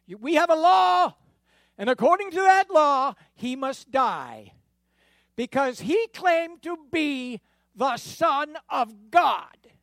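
high, loud priestly outcry; insistent shout. Acted. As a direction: hammer the law home at full voice. Chief priest insists Jesus must die.

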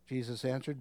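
ordinary talk

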